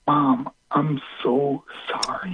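very slow, tearful, breath-filled cry; anguished apology. Retold or imitated. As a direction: cry it out hushed, stretching every word. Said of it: "looking up and just screaming".

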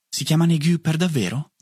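plain reading voice

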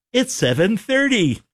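high-pitched ta-da after a countdown; high, triumphant radio time check; excited time call. Pretend. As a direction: announce the moment with playful fanfare. End of an on-air countdown.

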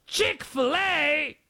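put-on slow, high, shouted British accent; drawn-out British tourist shout. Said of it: "My accents are awful".

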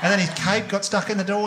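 ordinary delivery

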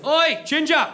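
loud, high mock British yell; aggressive British heckle. Man behind in line to ginger customer, mock British yell. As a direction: bellow it like a rude Englishman. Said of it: "pretty aggressively, by the way. And he goes".

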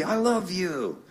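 easing down from a big exuberant greeting; imitated doting dad. Dad greeting his son on the phone, imitated affectionate call. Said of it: "my dad would always go".

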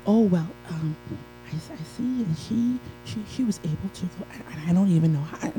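quiet, stumbling envious mumble; hesitant grumbling. Acted. Speaker voices a would-be hater watching others, hesitant grumbling. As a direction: trail along softly, faltering over someone else's success.

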